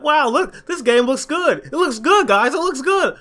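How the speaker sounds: high, gushing fake hype; mocking YouTubers gushing over footage, high and hyped; mock excited YouTuber. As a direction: parody an overexcited YouTuber fawning over gameplay. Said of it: "too excited looking at the Dino gameplay".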